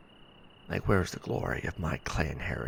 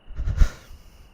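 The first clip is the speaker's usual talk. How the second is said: heavy grieving sigh; 10 dB louder than usual. Acted. Heavy sigh mid-sentence.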